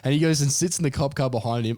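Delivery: usual speaking voice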